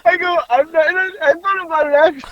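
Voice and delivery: high, laughing through words; real laughter. Real, not acted. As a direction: squeak it out mid-laugh. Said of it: "crying with laughter".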